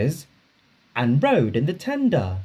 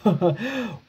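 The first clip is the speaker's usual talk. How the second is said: driver's laugh; 7 semitones higher than usual. Acted. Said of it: "laughed his driver".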